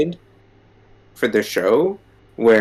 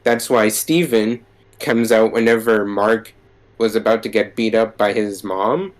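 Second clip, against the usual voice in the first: usual speaking voice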